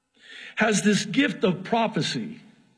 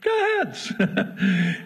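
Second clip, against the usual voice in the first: slow amused repeat; 3 semitones higher, much slower than usual. Acted. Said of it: "So he".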